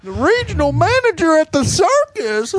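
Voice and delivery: high snooty mimicry; mock him grandly; mocking imitation. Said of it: "He's such a name dropper".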